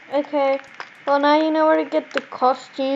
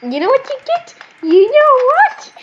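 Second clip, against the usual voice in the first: high, loud, building challenge; playful threat; 10 semitones higher, 5 dB louder than usual. Pretend. Mock threat before grabbing a weapon.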